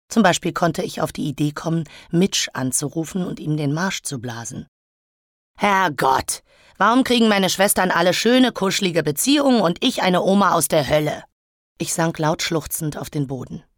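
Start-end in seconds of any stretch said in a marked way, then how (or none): 5.6-11.2 raised, higher-pitched exasperated lament; angry outburst. Acted. Elektra alone, railing at sisters' luck, exasperated outburst. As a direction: rail at your unfair lot. Drunk, furious self-pitying outburst.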